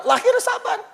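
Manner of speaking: high. Shown as a demonstration.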